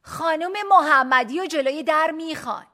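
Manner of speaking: high, loud announcement across a hall; loud call. A narrator's reading. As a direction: call out for someone in a crowd. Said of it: "با صدای بلند گفت". (صدا زدن با صدای بلند)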